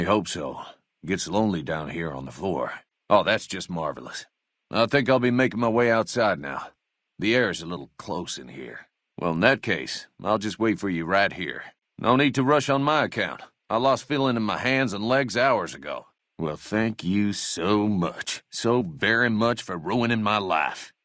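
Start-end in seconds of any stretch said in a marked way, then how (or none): 3.1-4.3 low, deflated grumble; dejected sarcasm. Acted. Deputy left tied up on the floor, dejected sarcasm. Sulky reply to being left behind. 16.4-20.9 slow, drawn-out sarcasm; sarcastic thanks. Acted. Deputy blames his would-be rescuer, slow sarcasm.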